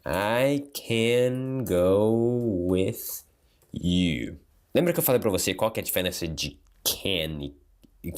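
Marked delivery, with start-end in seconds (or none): 0.1-4.4 "I can go with you" with can reduced to a quick schwa, drawn out slowly; slow demo, "can" said as weak "kn"; relaxed can. Shown as a demonstration. Teacher showing students the natural spoken form, slowly. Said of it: "você fala assim, ó".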